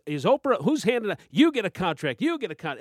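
loud, high Oprah giveaway shout; hammed-up talk-show giveaway; Oprah impression.

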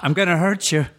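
creepy pleading sketch character; Old Greg voice. Retold or imitated. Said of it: "The Mighty Boosh".

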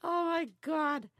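high, slow amazed exclaim. Real, not acted. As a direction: marvel at it, drawn out. Laughing at donkey memory.

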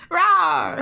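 playful flirty big-cat roar; dinosaur voice. Pretend. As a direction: growl it out like a teasing jaguar. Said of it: "It is fearsome and terrifying when you do that".

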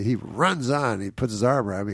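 ordinary talk

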